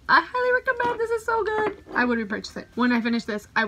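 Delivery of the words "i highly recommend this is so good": sing-song recommend. Shown as a demonstration. Repeats recommendation much higher.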